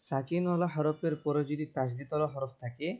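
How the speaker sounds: ordinary talk, nothing marked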